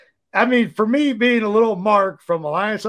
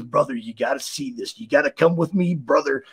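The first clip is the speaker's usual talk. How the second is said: Macho Man voice; 6 semitones lower, 4 dB quieter than usual. Retold or imitated.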